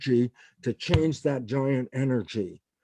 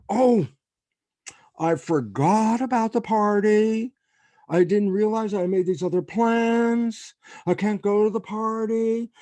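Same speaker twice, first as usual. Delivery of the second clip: higher, louder hammed-up regret; mock apology; 6 semitones higher, 4 dB louder than usual. Dodging the faculty party, telling colleague with mock regret. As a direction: play up the fake sorry excuse.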